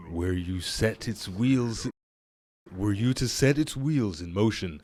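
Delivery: deep Qualok voice. Acted. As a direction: rumble it low like an ancient spirit. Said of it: "I may have gone a little bit too deep on Qualok's voice".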